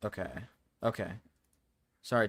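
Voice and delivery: ordinary talk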